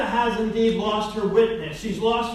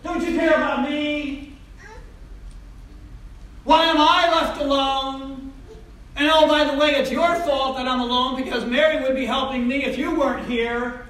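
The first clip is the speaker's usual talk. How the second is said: Martha confronting Jesus; 3 semitones higher than usual. Acted. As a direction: get in his face and demand. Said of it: "She gets up in his face".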